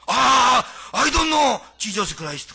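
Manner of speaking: loud, flustered brush-off; panicked denial. Retold or imitated. Peter denying Jesus to the servant girl, loud and flustered. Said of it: "하면서".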